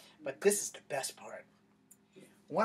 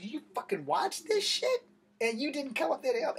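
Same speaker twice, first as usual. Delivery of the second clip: incredulous outrage; 10 semitones higher, 4 dB louder than usual. Retold or imitated. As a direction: hit him with how dare you. Outraged at coworker not helping.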